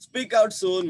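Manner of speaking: high, loud, impatient order; shouted command. A narrator's reading. Missus Grover ordering the boy to explain, shouted. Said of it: "Mrs Grover shouted".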